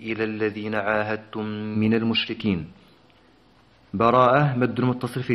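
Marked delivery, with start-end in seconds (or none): 0.0-2.7 Quran recitation. Shown as a demonstration. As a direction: recite the verse in measured, melodic chant. Teacher recites Quran verses.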